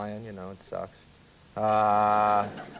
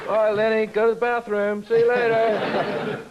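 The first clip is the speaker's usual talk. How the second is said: a hammed-up higher English accent, a touch louder; mock English accent; 8 semitones higher, 3 dB louder than usual. Comic seeing off Lenny at the bathroom, hammed-up English accent. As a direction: put on a jokey English accent for the send-off. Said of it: "There was my accent".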